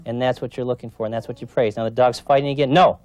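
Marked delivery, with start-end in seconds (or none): 2.7-3.0 harsh no. Shown as a demonstration. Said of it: "I used the harsh tone of voice no".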